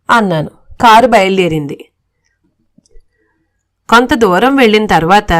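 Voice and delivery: ordinary delivery, nothing marked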